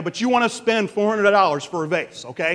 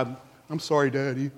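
put-on soft, low child's apology; a mild, subdued sorry; acted apologetic child; 5 semitones lower, 5 dB quieter than usual. Child apologizes to daddy after breaking vase, acted contrition. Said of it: "they're all apologetic".

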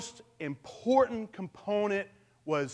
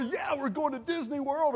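put-on higher, eager kid-in-the-car cheer; excited family; 7 semitones higher than usual. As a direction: sound thrilled to be off on vacation. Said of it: "everyone's real excited".

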